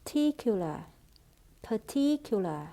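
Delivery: "particular" stressed on "TIC"; par-TIC-ular, weight on the second syllable. Shown as a demonstration. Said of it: "trọng âm rơi vào âm tiết thứ hai".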